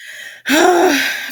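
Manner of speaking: big satisfied groan; loud happy sigh. Real, not acted. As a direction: let out a huge contented sigh. Overcome, full after meal.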